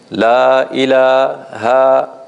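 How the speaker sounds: La ilaha stretched slowly, vowels held long and even; elongated recitation. Shown as a demonstration. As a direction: hold each long vowel in La ilaha for equal length. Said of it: "like this".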